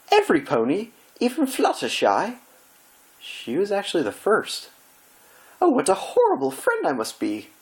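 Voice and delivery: high, anguished theatrical gasp; dismayed Rarity. Acted. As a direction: wail as if the world is ending.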